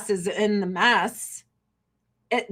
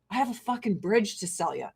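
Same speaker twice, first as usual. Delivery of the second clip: ordinary talk, nothing marked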